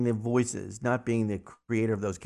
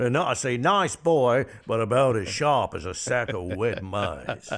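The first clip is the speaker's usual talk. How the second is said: Foghorn Leghorn voice. Acted. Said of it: "Foghorn Leghorn".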